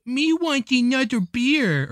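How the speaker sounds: put-on high, slow toddler lilt. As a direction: talk like a little kid. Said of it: "toddler lilt accent".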